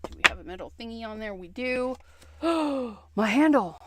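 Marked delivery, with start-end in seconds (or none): 2.4-3.8 gasp, then higher and louder; hammed-up panic over a handle; mock alarm. Real, not acted.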